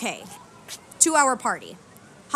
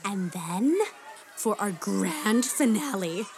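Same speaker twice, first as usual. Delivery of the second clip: singsong puppet show host. Acted. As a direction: pump up the kids like a children's entertainer. Grand finale announcement.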